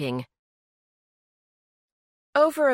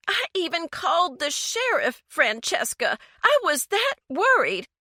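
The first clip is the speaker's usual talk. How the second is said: much higher, climbing to a pained cry; upset cry; 11 semitones higher than usual. A narrator's reading. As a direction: let the worry burst out high. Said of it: "Eleanor paused and then cried".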